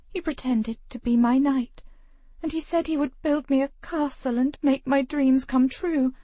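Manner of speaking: lightly voiced wistful young woman; a hint of a dreamy girl; young lady voice. A narrator's reading. Voices tender young Grace.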